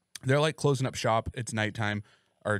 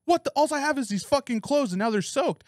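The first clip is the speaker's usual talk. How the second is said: put-on homeless girl's outrage; 13 semitones higher than usual. Retelling the soaked girl yelling at him, high indignant cry. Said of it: "she's like".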